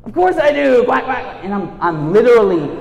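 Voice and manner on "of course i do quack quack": louder and higher, bluffing hard; loud fake confidence. Faking being a hockey fan to his friends, loud and bluffing. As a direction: bluff it out with a big brash voice. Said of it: "Do you watch hockey?".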